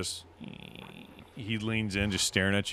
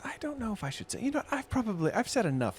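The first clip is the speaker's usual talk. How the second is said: shy barmaid voice; 14 semitones higher than usual. Acted.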